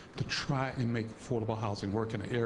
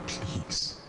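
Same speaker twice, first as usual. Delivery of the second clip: choked plea; 5 semitones lower than usual. Real, not acted. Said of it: "forgive me if I seem too emotional".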